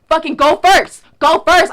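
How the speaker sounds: mock shout in ear. Teller voicing the rapper yelling in her ear, loud mock shout. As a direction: yell it right into someone's ear.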